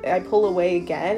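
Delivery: ordinary talk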